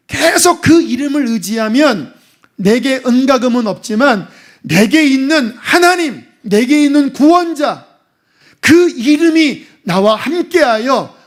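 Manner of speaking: shouted sermon declaration; fiery preaching. Acted. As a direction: proclaim the name with a ringing voice. Preaching at full force.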